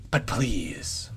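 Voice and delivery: a hint of a sly crone; slightly eerie old woman pleading; lightly voiced old woman. A narrator's reading. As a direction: coax gently. Brand voiced in story.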